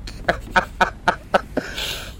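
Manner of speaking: high, wheezy laughter trailing on; laughing. Real, not acted. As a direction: crack up at your own story. Cracks up at own story.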